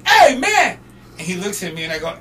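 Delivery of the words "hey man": high, loud hail; calling out. Retold or imitated. Fan hailing the roadie, calling out. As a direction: shout to catch his attention.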